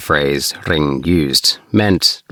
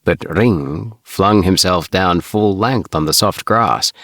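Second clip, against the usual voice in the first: ordinary delivery, nothing marked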